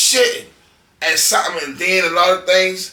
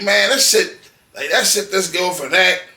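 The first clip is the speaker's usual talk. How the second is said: usual speaking voice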